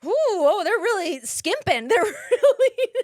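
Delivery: high, loud mock-offended gasp at skimpy food; a spoiled actor sniffing at the snacks; mock dismay. Retelling how she reacted to streamer craft services, mock dismay. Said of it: "I'm like".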